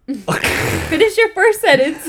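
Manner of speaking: laughing through the words. Real, not acted. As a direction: says it while cracking up. Laughing while cutting in.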